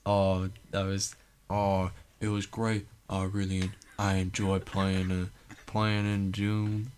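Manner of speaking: slow, drawling [name] impression; hammed-up [name] press-tour voice; [name] accent. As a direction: drag it out like [name] in an interview. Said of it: "now he's [name] doing a different accent".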